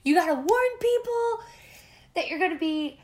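high, loud frantic whine; panicked meltdown. Retold or imitated. Yelling at her friend on the mountaintop in a panic. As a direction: shriek it like you're losing it. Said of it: "I had a full-blown meltdown".

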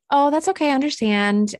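a hint of a friendly salon guy. Salon guy reassuring her on the phone, lightly voiced. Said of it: "the guy that I was talking to was like".

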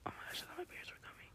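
quick, very soft whisper; hushed alarm. Real, not acted. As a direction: whisper fast, as if nearly caught. Afraid parents might hear.

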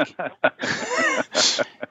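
high squeaky laugh. Real, not acted. Laughs at own story.

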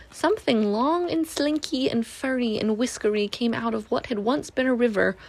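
usual speaking voice

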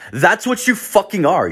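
high-pitched ranting outburst; indignant accusation. Retold or imitated. Storyteller ranting at the surrogate, indignant. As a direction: hurl the accusation, pitch jumping up.

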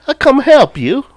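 high, loud British send-up; hammed-up British offer of help; mock British accent. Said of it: "Oh, British sentence".

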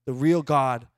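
ordinary delivery

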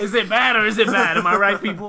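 loud ranting editor; barking newspaper editor. Acted. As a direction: rant at the crowd like an editor. Continues editor rant.

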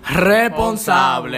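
"responsable" drawn out very slowly; "responsable" said slowly and pointedly, like a warning; stressed word. Shown as a demonstration. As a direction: lean hard on "responsable", stretching every syllable. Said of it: "vamos a recalcar la palabra".

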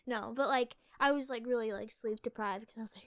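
ordinary talk

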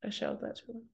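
dropping to a near whisper after yelling; loud then suddenly hushed. Real, not acted. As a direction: hush down, apologetic and quiet.

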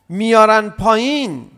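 usual speaking voice

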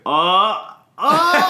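high, loud re-enacted "oh!"; excited game cries. Re-enacting players as ice pieces fall, excited cries.

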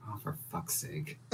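exasperated under-the-breath curse; muttered curse. Acted. Son caught by his mother, cursing under his breath. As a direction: sigh it out under your breath, fed up. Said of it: "Absco goes".

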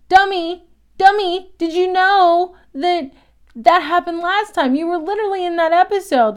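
바보 같은 캐릭터들에게 답답해하며 높고 큰 소리로 꾸짖듯이; scolding the characters. Retold or imitated. Speaker yelling at the characters.

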